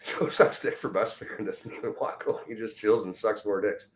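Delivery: breathy giggling under breath; laughing whisper. Real, not acted. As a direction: snicker it quietly. Laughing insult at a viewer.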